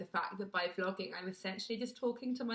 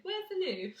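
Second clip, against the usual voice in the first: high mock question; 10 semitones higher than usual. Imitating people asking.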